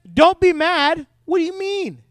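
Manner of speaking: high-pitched incredulous outburst; indignant disbelief. Acted. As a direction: throw the advice back, outraged. Acts Moses' outrage at Aaron.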